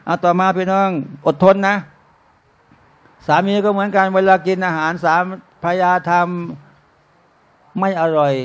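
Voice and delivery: ordinary talk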